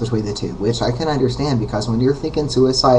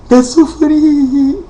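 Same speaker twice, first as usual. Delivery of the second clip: mock falsetto crack, much higher and louder; 6 dB louder, slower than usual. The other person mocking a voice crack mid-argument, high falsetto squeal. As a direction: squeak it up like a breaking voice. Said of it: "you crack during the argument".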